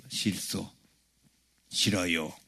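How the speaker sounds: low, quiet stubborn refusal; curt refusal. Retold or imitated. Told to vacate the room, a low, quiet refusal. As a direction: refuse under your voice, pitched well down.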